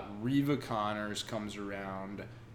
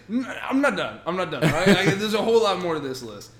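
raised, louder mock insistence; insistent; 7 semitones higher, 8 dB louder, faster than usual. Woman refusing to let Diamondback cut her list short, insistent. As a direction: hold the floor, half laughing.

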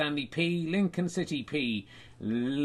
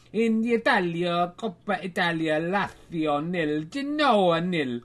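put-on Italian accent; 3 semitones higher, 4 dB louder than usual. Reading the Coppa Italia scores.

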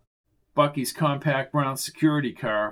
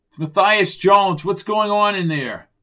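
loud, higher-pitched call; loud, urgent identification and demand; shouting through a door; 5 semitones higher, 6 dB louder than usual. Acted. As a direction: raise your voice to carry through a door.

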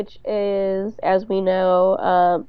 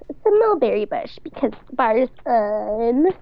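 playfully cutesy, pitched up at the start; 3 semitones higher than usual. Acted. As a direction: act adorably pleased with yourself. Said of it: "All, like, cutesy".

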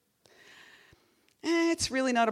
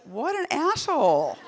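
amused scoff; 8 semitones higher, 6 dB louder, slower than usual. Retold or imitated. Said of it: "I was like".

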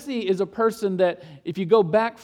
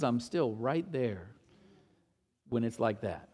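tender reassurance; 8 semitones lower, 8 dB quieter than usual. Retold or imitated. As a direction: hush it down like God comforting. God's comfort amid ruin.

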